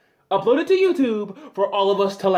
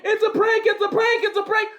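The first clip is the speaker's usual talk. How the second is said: much higher, shouted panicky whine; panicked prankster; 10 semitones higher than usual. Retold or imitated. Fake robber caught out, squealing excuse in high panic. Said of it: "I don't wanna hear".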